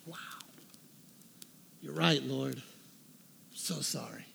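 very soft, low and slow; hushed repentance, almost to yourself; humbled apology. Retold or imitated. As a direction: drop to a near whisper, contrite.

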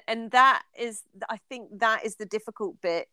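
normal talking voice

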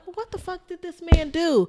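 high, disbelieving question; incredulous. Retold or imitated. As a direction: ask it in disbelief. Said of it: "You're like".

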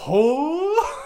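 high squeaky startled gasp; mock surprise, high and loud. Mocking a startled reaction.

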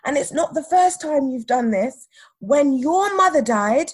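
high, mounting indignant reprimand; imitated scolding. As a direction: build to a loud, affronted telling-off. Acts manager's scolding tirade.

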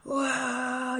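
long, high mock cheer; imitated crowd shout. Pool crowd greets the passenger, mock cheering shout. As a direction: cheer like a whole crowd at once. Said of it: "voltearon y me gritaron".

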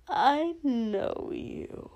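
high, slow, drawn-out and dreamy; spooky singsong recognition; eerie villain. Acted. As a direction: stretch it out in an eerie lilt. Sleepwalking villain recognises the hero.

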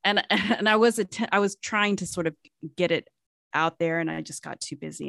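ordinary talk

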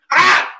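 short, loud mock scream; one sharp retold shriek; imitated scream. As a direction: act out a sudden startled yelp. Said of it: "Así gritó".

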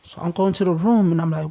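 ordinary delivery

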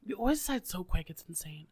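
fading into a whisper. Real, not acted. Teasing friend's quick choice, whispered. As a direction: let it die to a whisper. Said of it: "drops to whisper".

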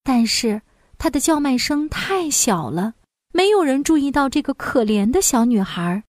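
usual speaking voice

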